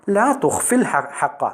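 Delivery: slow, high-pitched taunt; lightly acted sisterly mockery; mocking. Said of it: "പരിഹാസത്തോടെയാണ് Salma".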